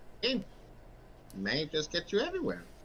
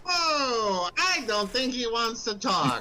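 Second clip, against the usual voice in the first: slow, higher amused merchant; 5 semitones higher, 5 dB louder, slower than usual. Acted. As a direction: play it light and teasing. NPC replies, amused.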